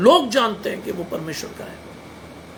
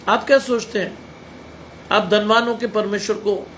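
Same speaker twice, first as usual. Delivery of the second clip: ordinary talk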